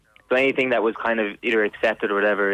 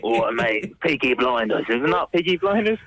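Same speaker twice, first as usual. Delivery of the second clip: intense London Cockney, hammed up; amateur Cockney impression, played for laughs; Cockney accent; 4 semitones higher, slower than usual. Shown as a demonstration. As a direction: put on a matey Cockney voice. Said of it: "have a conversation in Cockney".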